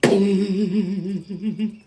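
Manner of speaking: long humming, pulsing heater rattle; imitated heater noise. Showing how the heater in his house went, imitated drone. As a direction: hum a wavering mechanical drone. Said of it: "went like this".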